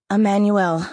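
loud, spat-out name; bitten out like a curse. Acted. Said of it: "bit it out like a curse".

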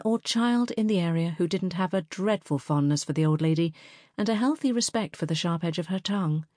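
plain reading voice

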